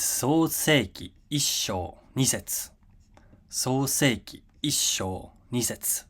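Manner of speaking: slow, deliberate reading of a chapter heading; measured teacher reading; reading Japanese for learners. Shown as a demonstration. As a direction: read it out slowly, syllable by syllable. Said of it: "I'll read the text twice".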